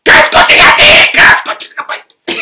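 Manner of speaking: shrieked, high and loud; an angry wife screaming at her husband; furious wife. Retold or imitated. Angry wife grabs knife, broom.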